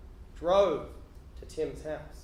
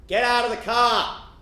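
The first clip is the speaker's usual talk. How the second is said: loud, slower order, less high; repeated police shout; 4 semitones higher, 9 dB louder, slower than usual. Retold or imitated. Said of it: "The police say again".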